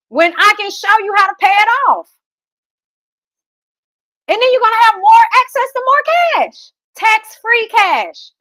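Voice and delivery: climbing to an eager sales peak; excited pitch. Real, not acted. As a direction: sell the payoff with rising hype. Sales pitch rising to a climax.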